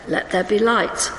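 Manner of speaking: normal talking voice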